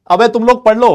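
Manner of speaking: loud, high telling-off; scolding shout. Real, not acted. Teacher telling chatty students to study, scolding shout. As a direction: raise the voice sharply at the class.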